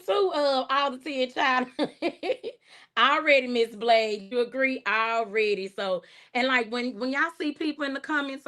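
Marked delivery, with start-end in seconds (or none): none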